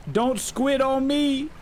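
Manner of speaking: high, slow whiny plea; mock plea. Begging his crew not to bail, high and slow. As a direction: drag it out like a playful whine.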